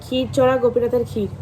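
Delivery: normal talking voice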